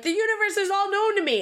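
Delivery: high airy mock proclamation; mock grand Elizabeth. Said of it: "she's like".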